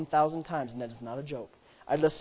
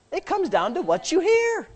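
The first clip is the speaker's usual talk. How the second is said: higher ringing take; 10 semitones higher, 3 dB louder than usual. Shown as a demonstration.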